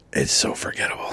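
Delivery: unvoiced whispered aside; dry whispered jab. Real, not acted. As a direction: breathe it like a secret. Whispered aside about the movie.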